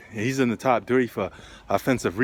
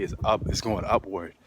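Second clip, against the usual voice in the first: usual speaking voice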